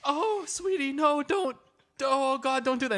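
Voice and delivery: high, put-on fretting caretaker voice; mock pleading. Sitters beg parrot to stop plucking, high mock pleading. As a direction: coax like a hammy worried pet sitter. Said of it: "So they'd be like".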